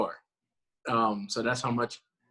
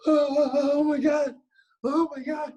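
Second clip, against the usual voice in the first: much higher, louder, slow and tearful; gasping near tears with shock; overwhelmed disbelief; 6 dB louder, slower than usual. Retold or imitated. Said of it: "And I was like".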